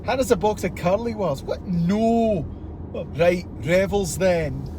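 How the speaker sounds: Scottish accent. Shown as a demonstration. Friend wants the Scottish repeated, Scottish accent. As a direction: act out a broad Scottish request. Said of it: "say that again. You said it so good".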